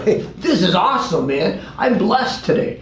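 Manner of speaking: high, bright-pitched sarcastic cheer, stretched out; mock cheerful. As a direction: play fake jolly gratitude. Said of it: "I don't go around going".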